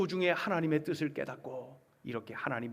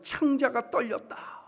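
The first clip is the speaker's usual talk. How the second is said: quoted anguish; 7 semitones higher than usual. Acted. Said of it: "뭐라고 표현하면".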